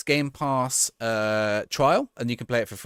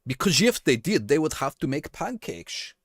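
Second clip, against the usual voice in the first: put-on a light, jokey Dutch accent for the punchline; mock Dutch accent; 3 semitones higher than usual. Streamer telling a joke about the Dutch Cup to chat, in a mock Dutch accent. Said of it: "Apologies to any Dutch people I offended with my accent".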